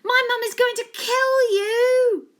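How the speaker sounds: loud, high, slow shout. A narrator's reading. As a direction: yell it out the window. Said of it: "A J shouted".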